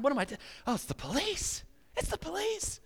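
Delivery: high, thin squeak of panic; mock panic. Driver spotting the police, high mock panic. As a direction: act the caught driver freaking out. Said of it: "these thoughts going through your mind".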